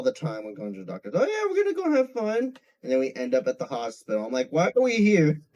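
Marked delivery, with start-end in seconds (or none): 1.2-2.6 much higher, sweet tricking mom; high, sugary mom voice; mom's fake-cheerful voice. Mom's voice in a childhood story. 4.5-5.4 put-on higher, louder little kid; high child voice asking why; confused kid. Child self in a story.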